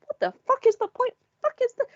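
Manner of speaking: high, baffled what-the-hell cry; baffled outburst. Retold or imitated. Viewer baffled by pointless flipping, high incredulous cry. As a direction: throw up your hands in disbelief. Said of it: "you're just like".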